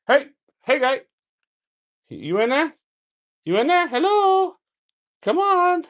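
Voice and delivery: high, sing-song calling to a hidden snake; coaxing the snake. Real, not acted.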